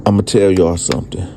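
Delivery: ordinary talk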